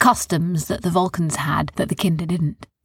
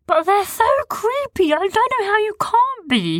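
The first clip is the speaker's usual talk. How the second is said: shudder at creepy things; creeped out. A narrator's reading. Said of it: "said Sniz".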